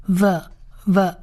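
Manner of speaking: V as a sound, "vuh", slowly; isolated, spaced-out "vuh" for V; letter-sound demo. Shown as a demonstration. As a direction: sound out V as a short syllable, vuh. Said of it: "那Vav发V的音".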